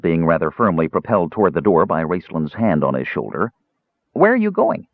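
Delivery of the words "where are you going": high, curious kender voice; a young kender asking bright questions. A narrator's reading.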